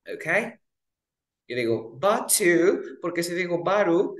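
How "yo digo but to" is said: but to slow, the t punched; slow but to, t clear; stressed t demo. Shown as a demonstration. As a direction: hit the t in but to. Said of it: "yo estoy estresándola".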